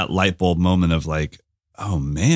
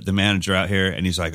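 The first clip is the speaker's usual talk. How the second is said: usual speaking voice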